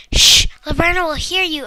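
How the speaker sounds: urgent scolding hush. Acted. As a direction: shush him sharply, scolding. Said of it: "said with a scolding voice".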